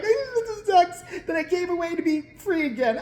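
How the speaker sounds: high-pitched fake TV sob; mock crying. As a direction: wail it in a squeaky put-on cry. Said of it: "crying, like, ten years ago".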